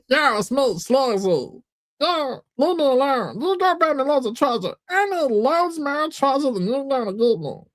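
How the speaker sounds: very high, whiny cartoon voice; Meatwad. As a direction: demand it in a squeaky put-on voice. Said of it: "It's just fucking Meatwad from Advent".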